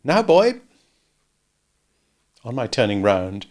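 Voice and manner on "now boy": put-on haughty girl. A narrator's reading. Estella voiced.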